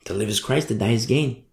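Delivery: to live is Christ said low and soft; softly, as a firm truth; quiet, settled conviction. Shown as a demonstration. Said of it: "That mindset only comes from Jesus Christ".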